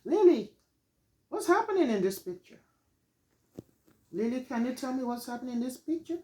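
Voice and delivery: put-on high, bright teacher prompt to a child; teacher asking Lily. Shown as a demonstration. Teacher prompting Lily about the picture, high and coaxing. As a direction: coax the child to describe the picture. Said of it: "I could say".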